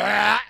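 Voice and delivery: high, loud "aah" of fleeing; mock yell. Brawlers fleeing extinguisher spray, high mock yell. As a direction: cry out like someone choking and running.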